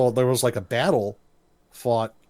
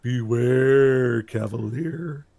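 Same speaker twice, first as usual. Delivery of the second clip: slow, drawn-out eerie warning; spooky mock-prophetic voice; ominous helmet warning; much slower than usual. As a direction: imitate an enchanted helmet, stretching the words. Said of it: "the eyes glow green and goes".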